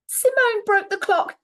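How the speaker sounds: put-on high, loud little-boy voice; Finn's fib. Little boy lying to Grandma, high and loud. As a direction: blurt it out like a guilty child. Finn blames his sister.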